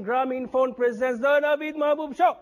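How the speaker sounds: show announcer. Real, not acted. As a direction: build up the show title and hold the last word. Host announcing show before the break.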